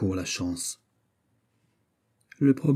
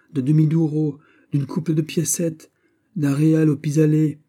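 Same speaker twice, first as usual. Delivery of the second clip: higher, louder, building plea; pleading list; 6 semitones higher, 5 dB louder than usual. Acted. Sailor pleading for the smallest coin, rising. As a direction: beg for any coin.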